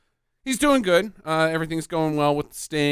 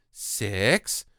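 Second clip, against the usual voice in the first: echoing mind voice; 7 semitones higher, 4 dB quieter, much slower than usual. Acted. Girl's age echoing in his mind.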